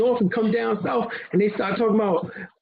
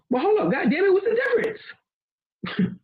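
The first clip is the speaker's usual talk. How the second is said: mock outrage; 10 semitones higher than usual. Asking the listener, in mock disbelief, how two greetings differ. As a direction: stretch it out high in fake disbelief.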